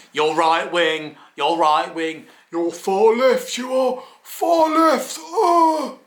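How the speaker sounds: drawn-out accusation climbing into high, mock wail; mock accusing. Accusers labelling him right wing or far left, mock hysterical accusing. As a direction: imitate hysterical accusers, slowly rising in pitch.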